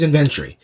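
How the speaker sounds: "inventory" as "in-VEN-tree", stress on VEN; British pronunciation. Retold or imitated. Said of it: "inventory becomes inventory".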